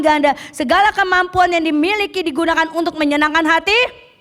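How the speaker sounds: loud, high rousing sermon climb; preaching at full force. Acted. Preacher rousing the congregation to serve, loud and high. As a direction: drive the point home to the church.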